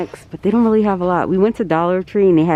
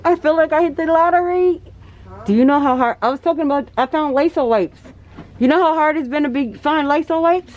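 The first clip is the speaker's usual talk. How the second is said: excited; 9 semitones higher than usual. Real, not acted. Thrilled to find Lysol wipes.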